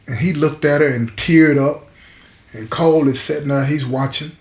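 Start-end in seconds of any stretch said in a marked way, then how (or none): none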